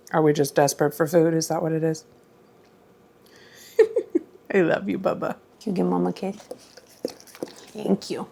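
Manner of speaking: fast, doting dog-owner voice; sweet talk to the family dog, quietly; pet talk. Real, not acted. Talking to her dog.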